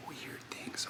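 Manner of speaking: hushed whispered echo; whispering. Shown as a demonstration. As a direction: whisper it back to yourself.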